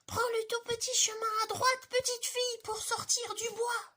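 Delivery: very high squeaky little bear calling; tiny bear calling directions to the girl; tiny bear cry. Acted. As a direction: chirp helpfully like a tiny cub. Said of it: "eut la bonne idée de crier aussi".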